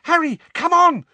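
very high, loud shriek. A narrator's reading. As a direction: shriek it over the crowd. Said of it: "shrieked Hermione".

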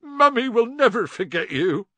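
high, loud tearful wail; sobbing. A narrator's reading. Hagrid parts from Norbert in the crate, sobbing. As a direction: wail it like a heartbroken mother. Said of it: "Hagrid sobbed".

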